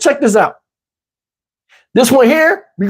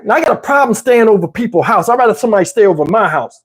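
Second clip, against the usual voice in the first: ordinary delivery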